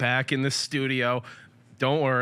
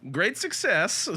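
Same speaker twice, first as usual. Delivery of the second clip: higher comic voice quoting a catchphrase; catchphrase impression; 6 semitones higher, slower than usual. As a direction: pop the catchphrase out in a put-on voice. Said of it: "Had to do that for David there".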